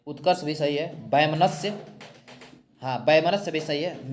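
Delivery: ordinary talk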